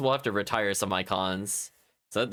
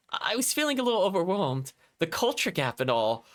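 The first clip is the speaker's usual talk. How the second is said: put-on sweet higher-pitched girl; 6 semitones higher than usual. [name] apologising sweetly in a higher voice.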